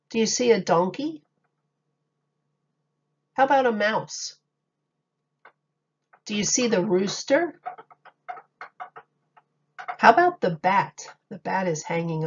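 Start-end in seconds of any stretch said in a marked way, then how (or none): none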